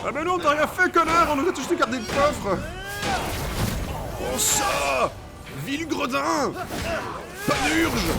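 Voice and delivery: high, loud hammed-up yelling; mock furious insults. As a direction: scream theatrical insults mid-fight. Insulting enemies while fighting.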